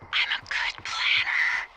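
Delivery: put-on slow, loud, drawn-out drunken boast; tipsy boast. Tipsy woman answers "good plan" over the radio, drawn out. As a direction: stretch the words out, tipsy and loud. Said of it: "I am getting drunk".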